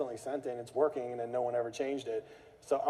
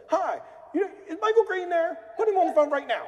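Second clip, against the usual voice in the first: much higher, louder demand; mock shouting caller; 6 dB louder than usual. Caller demanding Michael Green on the phone, mock shout. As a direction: bark for the boss right now.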